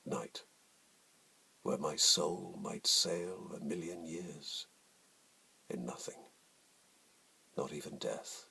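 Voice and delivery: slow, hushed sonnet reading, fading to a whisper; poem recitation. Acted. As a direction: let each image hang in the silence.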